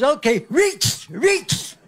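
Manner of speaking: high slow lisping voice; a lightly lisped nervous rehearsal; lateral lisp. Retold or imitated. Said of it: "he has a lateral lisp".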